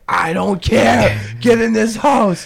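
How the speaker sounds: high, slow, loud hammed-up order; mock annoyed yell. Yelling at the drunk friend to get inside. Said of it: "And I'm like".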